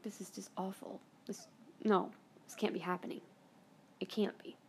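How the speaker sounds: tearful. Retold or imitated. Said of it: "I'm literally in tears".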